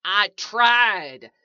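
loud, frustrated insistence; panicked protest. A narrator's reading. Trapped man protests again.

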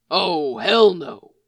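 gruff outburst of dismay; exclaiming. A narrator's reading. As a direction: drag out the dread. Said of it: "he exclaimed".

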